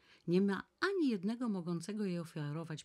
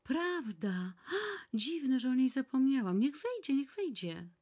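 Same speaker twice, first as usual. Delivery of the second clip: excited; 10 semitones higher, 7 dB louder than usual. Acted. Nana suddenly remembers eager visitor.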